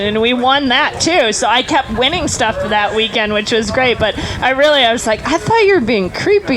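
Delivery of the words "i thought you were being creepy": high-pitched mock confession; re-enacted awkward admission, pitched way up; retold blurt. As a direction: blurt it out in a raised voice. Retold confession to flirty friend.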